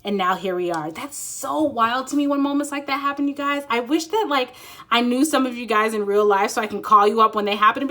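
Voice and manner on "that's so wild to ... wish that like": ordinary talk, nothing marked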